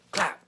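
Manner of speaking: sharp, loud, high clap sound; mock arrow smack. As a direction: snap out the noise of an arrow hitting wood. Imitating arrow hitting tree.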